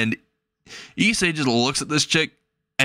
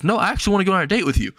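higher, fast, eager asking; eager girl voice; 6 semitones higher, much faster than usual. Acted. Chasing girl asking for a date, higher and fast. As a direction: rush it out, bright and pushy.

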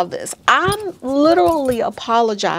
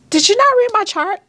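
high, louder, incredulous outrage; incredulous retort; 11 semitones higher, 4 dB louder, faster than usual. Retold or imitated. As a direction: fire back in disbelief at the doctor. Outraged reply to the doctor.

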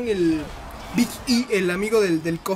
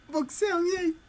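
giggling through the words, higher and slower; laughing; 7 semitones higher, 5 dB quieter, slower than usual. Real, not acted. Gamer repeating a silly title, laughing. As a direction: crack up while repeating the title.

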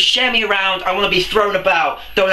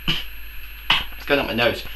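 breathy whisper to himself; 7 semitones lower, 6 dB quieter, slower than usual. Real, not acted. Egg up his nose, whispering to himself. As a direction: murmur it under your breath in dismay.

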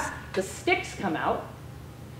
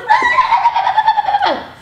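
huge high shout of laughter; loud shrieking laugh; 11 dB louder than usual. Real, not acted. Recalling the kids' dog pile, shrieking laugh. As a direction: burst out laughing loudly.

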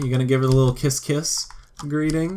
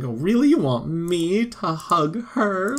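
higher, slowed-down eager voice; mock excited sim; 8 semitones higher than usual. Voicing the sim excited to hug Matilda, hammed-up eager. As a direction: play him as thrilled at the idea. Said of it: "he was excited".

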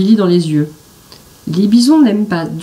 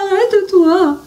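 much higher pleading cry; exhausted shout; 12 semitones higher than usual. A narrator's reading. Billy on the galloping bison, pleading exhausted. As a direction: beg at the end of his strength. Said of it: "crie Billy à bout de force".

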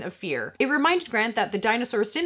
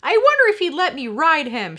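put-on high, louder kid voice; girlish wish; 7 semitones higher, 7 dB louder than usual. A narrator's reading. Lex daydreaming about riding Ralph, girlish. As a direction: wish out loud like a child.

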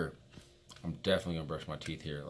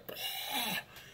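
sharp breathy hiss from throat burn; 4 dB quieter than usual. Real, not acted. Nicotine burn in his throat.

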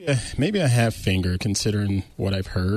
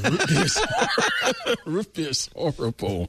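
laughing; 5 semitones higher than usual. Real, not acted. Laughs while rejecting root beer.

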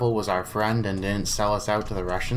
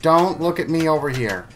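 urgent plea; 4 semitones higher than usual. Pretend. Pleading with guards to look away.

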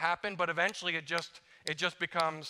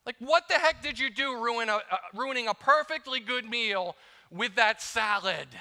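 mock outrage; 7 semitones higher, 5 dB louder than usual. Husband complains to his wife about the salad, hammed-up outrage. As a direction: act offended by the salad.

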